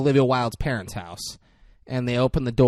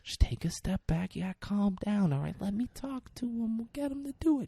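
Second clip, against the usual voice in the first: calming; 7 semitones higher, 9 dB quieter than usual. Retold or imitated. Lawyer calming him down.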